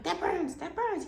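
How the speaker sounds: high, urgent protest; alarmed complaint. Retold or imitated. Patient to anesthesiologist about the IV, alarmed. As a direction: protest in acted pain.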